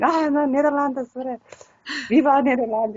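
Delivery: lightly hammed protest for the Netherlands; teasing voice of an upset Dutch listener; mock offended Dutch fan. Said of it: "people gonna go".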